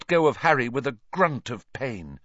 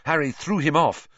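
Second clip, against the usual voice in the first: plain reading voice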